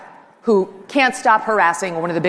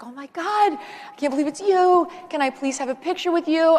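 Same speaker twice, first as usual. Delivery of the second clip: excited fan; 9 semitones higher than usual. Retold or imitated. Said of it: "And he was like".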